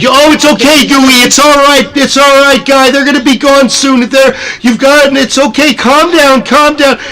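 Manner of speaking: high, sing-out mock soothing; happy character. Happy character calming screaming Gooey in front of guests, high-pitched hammed-up soothing. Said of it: "like, this happy character".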